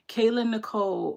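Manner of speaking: normal talking voice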